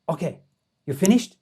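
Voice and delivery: terrible imitated Tibetan-leader accent, rising question; Dalai Lama. Checking the visitor has finished, in a bad Dalai Lama accent. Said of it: "I'm doing a terrible Dalai Lama accent".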